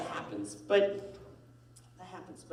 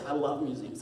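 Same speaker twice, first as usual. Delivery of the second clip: ordinary delivery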